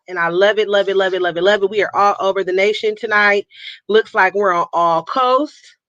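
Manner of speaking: ordinary talk, nothing marked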